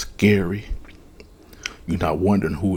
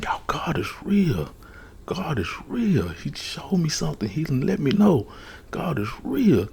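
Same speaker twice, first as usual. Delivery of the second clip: high, elated retold proclamation; joyful excitement; 8 semitones higher than usual. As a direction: relive telling everyone the amazing news, pitched high. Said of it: "I'm saying this with joy and excitement".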